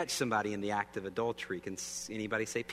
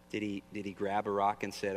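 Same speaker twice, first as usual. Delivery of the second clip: ordinary delivery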